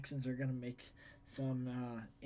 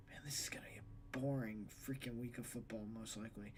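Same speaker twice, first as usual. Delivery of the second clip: whispered grumble; 5 semitones lower, 10 dB quieter than usual. Real, not acted. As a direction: mutter it under your breath. Muttered complaint aside.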